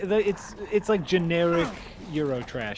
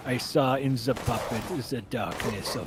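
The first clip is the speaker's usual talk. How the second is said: Werner Herzog impression; 3 semitones lower, 4 dB quieter than usual. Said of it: "my Eurotrash is a little Werner Herzog".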